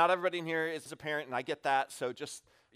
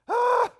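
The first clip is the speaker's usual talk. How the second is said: sudden high, loud burst of exasperation; short, loud cry of an exasperated dad; 11 dB louder than usual. Retold or imitated. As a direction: let out a fed-up yelp. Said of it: "give this kind of exasperated".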